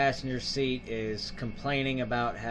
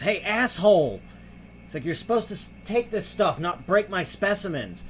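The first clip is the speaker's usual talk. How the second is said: raised, higher-pitched scolding, retold; mock angry outburst; 8 semitones higher, 5 dB louder than usual. Passenger scolding the hijacker who broke things, mock angry. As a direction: play an indignant passenger telling someone off.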